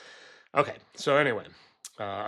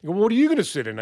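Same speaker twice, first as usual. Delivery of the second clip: higher, louder questioning voice; mimicking neighbor's objection; 9 semitones higher, 4 dB louder, much faster than usual. Neighbor asking what he'll sit in, higher mock concern. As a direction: push back in the neighbor's worried tone. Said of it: "I said".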